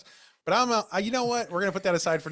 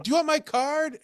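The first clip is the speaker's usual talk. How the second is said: high bright voice of the salesman calling after; 12 semitones higher than usual. Retold or imitated. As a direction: play the hopeful clerk.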